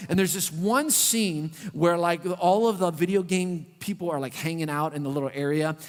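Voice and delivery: normal talking voice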